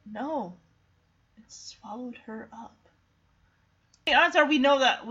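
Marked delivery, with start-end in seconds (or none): 0.1-2.7 low, very quiet and hopeless; hushed, grieving retold line; dejected. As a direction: say it low, as if all hope is gone. Acting Shadow giving up on cat.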